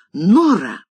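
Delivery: loud, cutting call of her name; sharp. A narrator's reading. Mistress cutting off chattering maid, sharp. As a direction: snap her name to stop her. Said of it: "Голос хозяйки прозвучал так резко".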